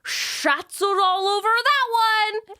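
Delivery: put-on slow booming New Mexican accent shout; coach's yelled New Mexican accent. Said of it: "who has the loudest New Mexican accent".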